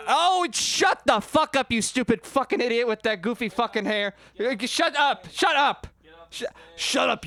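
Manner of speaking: high, loud furious scolding; angry yelling at heckler. Shown as a demonstration. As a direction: scream him down from the stage. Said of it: "I'm gonna do an example".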